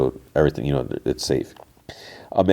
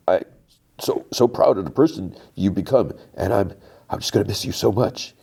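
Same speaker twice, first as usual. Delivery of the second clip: choking up; 3 semitones higher than usual. Retold or imitated. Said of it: "he's kinda starts choking up".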